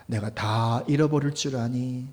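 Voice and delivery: usual speaking voice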